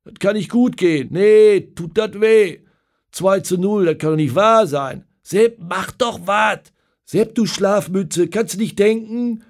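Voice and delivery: loud, high, jeering pub shouts; crowd outrage. Acted. Pub regulars shouting at Herberger during the match, loud jeering. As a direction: rail at the coach and players.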